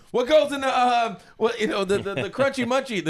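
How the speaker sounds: high, halting, flustered manager voice; clueless manager. Retold or imitated. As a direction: fumble for the words in a high mock voice. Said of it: "the manager doesn't really know the ingredients".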